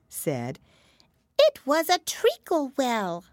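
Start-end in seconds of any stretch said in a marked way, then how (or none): 1.4-3.2 high, slow squeaky dormouse; drowsy little mouse voice; sleepy snoring dormouse. A narrator's reading. Dormouse character voice in story.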